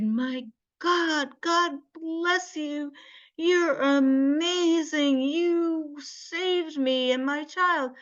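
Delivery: high, slow, drawn-out thanks; overwhelmed gratitude. Retold or imitated. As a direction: imitate a grateful mother, high and stretched out. Retold grateful mother exclaiming in relief.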